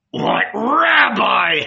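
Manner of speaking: high, drawn-out furious howl; scornful howl. A narrator's reading. Judas mocking Peter's respect for Jesus, scornful howl. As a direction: howl the question with contempt. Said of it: "howled Iscariot".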